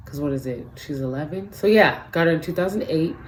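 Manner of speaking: usual speaking voice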